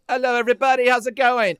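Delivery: loud, high mock British stage greeting; British accent. Greeting the crowd at one song, loud put-on British accent. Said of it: "his accent never sticks".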